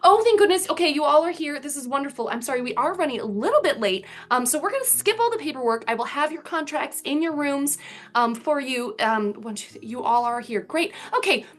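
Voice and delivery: high, hurried halfling assistant; flustered assistant. Acted. Halfling assistant rushing the heroes along, flustered. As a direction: bustle in breathless and rushed. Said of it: "bustling in with, arms full of paper".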